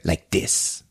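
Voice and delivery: low, soft and controlled; mock calm menace. Gunman turns the gun on himself, imitated low quiet demonstration. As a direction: say it quietly, the opposite of a screech.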